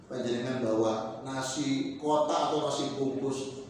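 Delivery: normal talking voice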